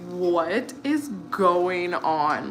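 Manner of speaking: slow, drawn-out disbelief; retold shock. Streamer reacting to the video about schoolchildren, shocked. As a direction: stretch each word out in mock shock. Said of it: "I was, like, so shocked".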